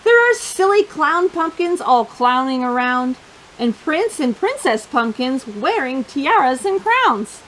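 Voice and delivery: usual speaking voice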